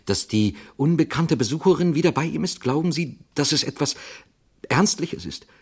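frightened actor asks the priest, high and anxious; fearful question. Acted. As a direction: ask it with rising fear. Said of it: "in dessen Augen Angst aufstieg".

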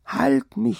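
very high, desperate cry. A narrator's reading. Sick wife begging her husband to hold her, crying out. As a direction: cry out high like a delirious patient. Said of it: "schrie sie".

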